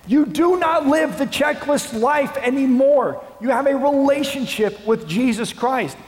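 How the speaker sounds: high, loud, impassioned plea; fervent preaching shout. Acted. Paul writing to the Galatians church, fervent loud plea. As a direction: proclaim it like Paul rebuking the church. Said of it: "and says".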